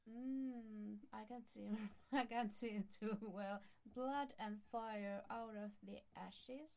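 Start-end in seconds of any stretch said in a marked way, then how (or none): none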